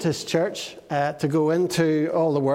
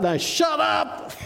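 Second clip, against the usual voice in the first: commanding shout; 8 semitones higher, slower than usual. Acted. Jesus orders the wind and waves, shouting. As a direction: shout the storm down. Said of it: "The actual translation is quiet or".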